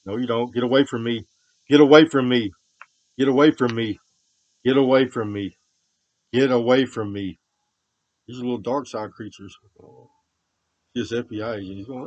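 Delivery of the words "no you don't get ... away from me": sudden furious screaming, slowing on the last line; furious shout. Real, not acted. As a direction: bark them away at full force. Yelling at someone to leave.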